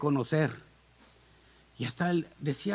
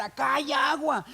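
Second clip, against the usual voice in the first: high answering call from afar; pitched-up shout in reply; calling back; 7 semitones higher than usual. Acted. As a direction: call back like a distant deer. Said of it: "otro ciervo por allá le conteste".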